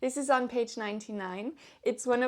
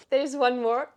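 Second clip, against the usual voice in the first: ordinary delivery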